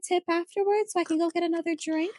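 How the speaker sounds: high, fast sugary server voice; chirpy service voice angling for a tip; customer service voice. Retold or imitated. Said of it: "I was customer service rep".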